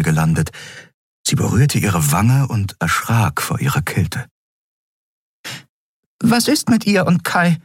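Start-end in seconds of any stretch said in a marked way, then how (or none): 6.2-7.6 high, worried fairy question; a tiny girl's voice, anxious; small fairy voice. A narrator's reading. Nelen asks Keru about the unconscious captain, high and worried.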